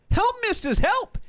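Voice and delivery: put-on high, loud boy's cry for help; squealing kid alarm; yelling at windows. A narrator's reading. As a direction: shout up to the grown-ups, shrill and excited. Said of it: "yelling at the windows".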